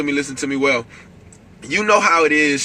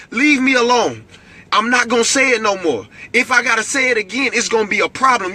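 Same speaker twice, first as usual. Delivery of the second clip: higher, louder commanding telling-off; stern escalated warning; 9 semitones higher, 3 dB louder than usual. Shown as a demonstration. Preacher ordering depression to sit down, stern raised voice. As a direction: order depression away like a naughty child. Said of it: "your voice has to get escalated".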